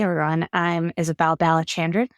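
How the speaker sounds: ordinary delivery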